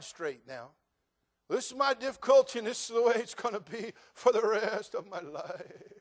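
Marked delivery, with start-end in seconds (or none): 1.5-5.9 high, loud bleating complaint; mock a self-pitying moaner, bleating like a goat; goat-like whine. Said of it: "you sound like a goat when you talk like that".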